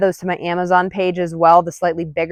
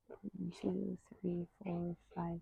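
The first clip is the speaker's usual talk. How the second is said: much softer, barely voiced counting; under-breath count; 28 dB quieter, slower than usual. Real, not acted. Trainer counting duration under her breath. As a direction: count quietly to yourself.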